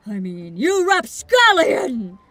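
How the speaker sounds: slow, loud and accusing; bombastic detective. Acted. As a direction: play it like a grand accuser. Detective confronts a thief.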